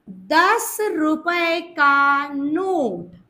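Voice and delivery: very slow, drawn-out teaching delivery; stretched, deliberate dictation. Shown as a demonstration. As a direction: spell out the phrase word by word for a class. Teacher dictates the key phrase.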